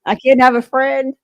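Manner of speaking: high, loud mock indignation; indignant what. Elliot protests to Nate, high indignant mock outrage. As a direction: play it offended and innocent, way up high. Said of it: "Elliot just looks at him like".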